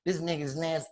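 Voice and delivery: ordinary delivery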